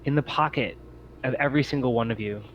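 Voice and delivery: ordinary talk, nothing marked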